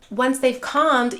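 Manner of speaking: ordinary talk, nothing marked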